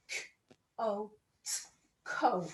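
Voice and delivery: "C O T" letter by letter, then blend "Coat"; sounding out. Shown as a demonstration. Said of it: "Sound out coat".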